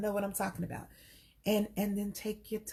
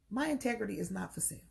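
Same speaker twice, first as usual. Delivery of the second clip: ordinary delivery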